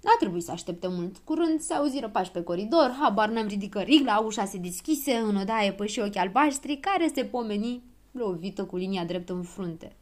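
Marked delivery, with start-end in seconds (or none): none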